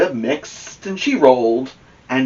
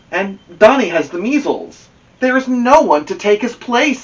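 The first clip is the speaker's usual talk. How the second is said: put-on higher, louder, gloomy boy's voice; a boy complaining his teammate is out; upset boy; 9 semitones higher, 4 dB louder than usual. Team boy tells Amelia Bedelia their bad news, higher and upset.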